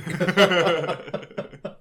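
loud high laugh; high burst of laughter. Real, not acted. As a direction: break into laughter. Laughter after quote.

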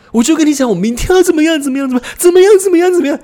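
loud, high, hammy drama-student delivery; overacted line reading. Student overacting a script in class, high and loud. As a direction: ham it up like an over-eager actor. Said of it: "我要讲什么台词".

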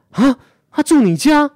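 high, loud retold shriek of disbelief; imitating a friend's stunned cry; shocked disbelief. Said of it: "然后我朋友很惊讶". (模仿朋友震惊地尖叫)

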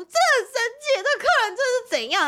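high, exasperated complaint; flustered staff. Retold or imitated. Staff hanging up on the customer, high exasperated. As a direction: squeal it like staff fed up after the call. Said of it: "他们就挂掉电话说".

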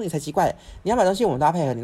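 angry retort. Retold or imitated. Daughter hitting back at mom in the store, loud anger.

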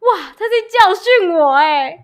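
high, loud, slow astonished gasp of words; playing up her disbelief; wide-eyed mock outrage at the scolding; amazed. Amazed at being lectured.

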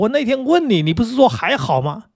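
high, indignant scolding; a father demanding answers over failed results; angry father. Retold or imitated. Father scolding his son who failed, high and indignant. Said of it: "他爸爸就很生气说".

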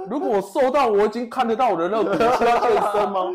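laughing rant about not knowing where ribs are; exasperated outburst. Real, not acted. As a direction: rant at the coach, fast and loud. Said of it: "我就很生气".

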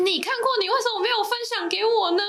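high, hurt complaining voice; mock wounded partner; whiny partner voice. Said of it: "你们没有被说".

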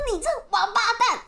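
high, mock-furious insult; hammed-up cursing. Imitating the old man cursing her, high mock fury. Retold insult in escalating quarrel.

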